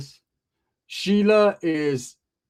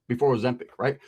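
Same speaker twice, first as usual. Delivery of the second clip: ordinary delivery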